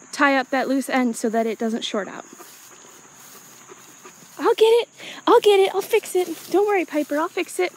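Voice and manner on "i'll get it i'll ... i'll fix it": higher-pitched reassurance to an animal; reassuring a pet. Real, not acted. Keeper promising Piper she will fix the fence, reassuring a pet. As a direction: soothe a worried pet from a little way off.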